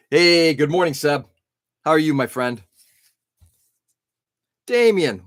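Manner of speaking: ordinary talk, nothing marked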